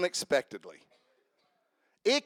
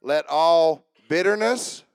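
slow scripture emphasis; 5 dB louder, slower than usual. Shown as a demonstration. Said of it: "I want you to watch this".

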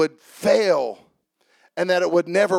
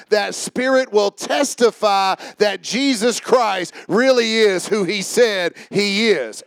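ordinary talk

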